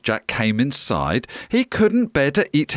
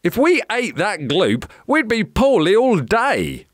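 put-on high-pitched boyish protest; groaning boy; 7 semitones higher than usual. A narrator's reading. Jack refuses the giant's slime, high groaning protest. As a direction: refuse like a disgusted child. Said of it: "groaned Jack".

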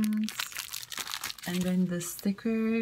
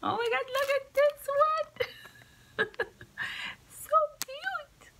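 very high squeal breaking into giggles; giddy, high-pitched gushing; delighted squeal. Real, not acted. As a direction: squeak with delight at something cute. Gushing over a cat gift.

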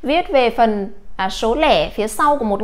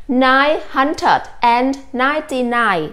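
slow and careful, each word spaced out; slow model reading; slower than usual. Shown as a demonstration. Teacher reading a number slowly to students, slow and careful. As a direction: read it slowly for the class to copy. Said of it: "chúng ta sẽ đọc là như sau".

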